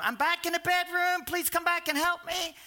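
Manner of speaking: a half-acted cry from the bedroom; mock call for help. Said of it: "my mom starts screaming out".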